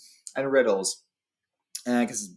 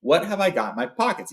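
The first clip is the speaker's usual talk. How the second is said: slightly raised, higher voice; a bit louder; 6 semitones higher than usual. A narrator's reading. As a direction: repeat the question a little more firmly. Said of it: "he said louder".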